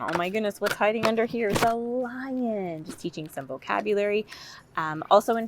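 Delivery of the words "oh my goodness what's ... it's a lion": storytime reveal. Shown as a demonstration.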